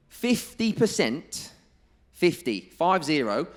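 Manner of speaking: "Fifty" pitched high, loud and drawn out; loud, high hammering of "fifty" and "five zero"; emphatic figure. Real, not acted. Hammering a striking statistic home.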